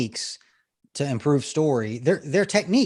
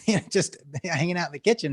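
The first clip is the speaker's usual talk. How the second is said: usual speaking voice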